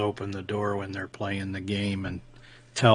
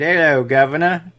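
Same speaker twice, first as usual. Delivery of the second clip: loud, higher-pitched mock Cockney greeting; Cockney impression; 7 semitones higher, 13 dB louder than usual. Joking about how Brits greet each other, mock Cockney accent. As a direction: belt out a hammed-up Cockney hail. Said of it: "the Cockney".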